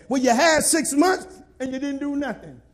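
ordinary delivery

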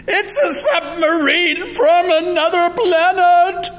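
high, loud alarmed coach; screaming at full height; panicked shriek. A narrator's reading. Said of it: "shrieked the coach".

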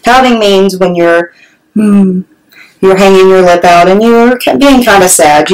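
usual speaking voice

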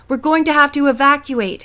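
captain shouting to his passengers; shouted order. A narrator's reading. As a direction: shout it over the howling wind. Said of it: "he shouted".

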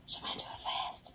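whispered, voiceless, very soft. Real, not acted. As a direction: drop to a hushed whisper. Same line, then whispered.

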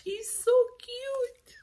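very high squeal of adoration; gushing over cuteness. Real, not acted. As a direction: coo it in a tiny high voice.

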